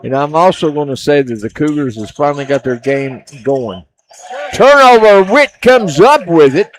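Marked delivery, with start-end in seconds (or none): 4.5-6.7 excited call. Real, not acted. Live call of a turnover.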